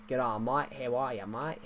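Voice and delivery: broad Aussie greeting; Australian accent. Shown as a demonstration. Streamer shows off an Australian accent to viewers, Australian accent. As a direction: put on an Australian twang. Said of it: "I can do an Australian accent".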